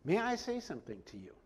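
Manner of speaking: soft, formal and polite request. Shown as a demonstration. Paul politely asks the tribune for a word, formal. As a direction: ask with courtly respect. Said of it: "says it very formally, very politely".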